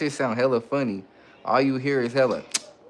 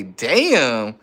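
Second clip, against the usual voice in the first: usual speaking voice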